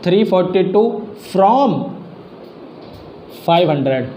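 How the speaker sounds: spelling out "from" word by word; slow dictation. Shown as a demonstration. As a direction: dictate slowly to the class. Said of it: "अब यहाँ from क्यों लगाते हैं". (धीरे-धीरे बोलकर लिखवाना)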